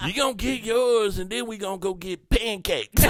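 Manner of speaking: a hint of a cocky lover; lightly voiced bragging hookup guy; mock smooth player. Mocking himself as a cocky hookup. Imitates his own hookup line.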